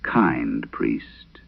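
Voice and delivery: low, sly demon murmur; lightly voiced creeping demon; low whisper. A narrator's reading. Possessed girl mocking the priest, low sly murmur. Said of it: "it whispered".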